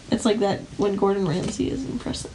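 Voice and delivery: ordinary delivery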